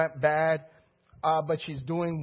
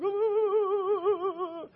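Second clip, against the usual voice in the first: long, high wavering "ah"; imitated wail. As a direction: moan it high and sustained, mimicking her collapse. Imitates woman sinking down.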